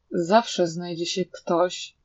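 ordinary delivery, nothing marked

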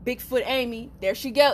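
ordinary talk, nothing marked

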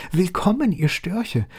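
high, joyful owl cry. Acted. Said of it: "rief sie".

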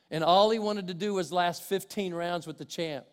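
ordinary talk

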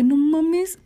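high-pitched repeated disbelief; retold disbelief. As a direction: relive the realization, voice pitched well up. Acts out her disbelief.

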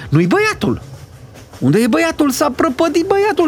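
alarm climbing to a very high pitch; panicked cry. A narrator's reading. Lady crying that the boy is lost, panicked cry. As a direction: escalate into a high wail of panic. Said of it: "țipă cucoana".

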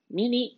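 high two-beat horn honk; imitated car horn. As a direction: mimic a car horn beeping twice. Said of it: "We hear a horn".

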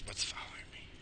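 hushed, wary whisper; paranoid whisper. Real, not acted. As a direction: whisper it as if being tailed. That's how fucking paranoid I am.

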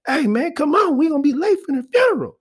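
high, fast mock uncle call; high hurried uncle yelling; loud uncle. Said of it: "and he goes".

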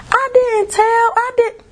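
very high squeaky mock voice; mocking denial. Therapist denying to the furious girl, mocked in a squeaky voice. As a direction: squeak it out like a lying whiner.

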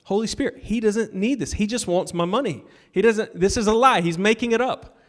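mock protest, pitch climbing as it goes; retold arguing. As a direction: plead your case, half in earnest. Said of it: "arguing with the Holy Spirit".

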